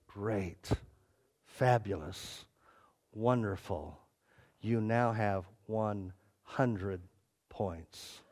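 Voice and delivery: low, soft, slow pronouncement; hushed approval. Retold or imitated. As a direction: let each word land gently after the outburst. Peter quietly grants the full score.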